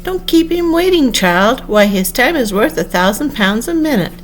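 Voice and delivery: high sing-song chorus voice; many voices in chorus, high and lilting. A narrator's reading. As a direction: chant it like a crowd in chorus, pitched high. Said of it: "Like the chorus of a song".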